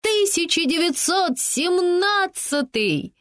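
high, slow ringing shout; crowd shout. A narrator's reading. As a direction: call it out slowly to the crowd. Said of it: "Крики постепенно затихли".

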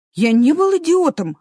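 high, loud hurt protest; protest, stung and offended. Acted. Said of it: "обиделся Эразм". (обиженно)

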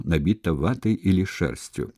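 ordinary delivery, nothing marked

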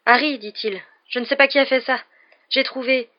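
put-on a panicked boy blurting out the news; stammering out bad news, on edge; flustered, anxious. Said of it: "semblait dans tous ses états".

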